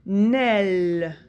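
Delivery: "Nel" very slowly, both consonants held; syllable sounded out. Shown as a demonstration. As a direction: stretch "Nel" into one long blended syllable. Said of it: "ça va faire Nel".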